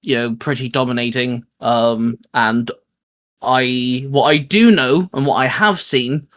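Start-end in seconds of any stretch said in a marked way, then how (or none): none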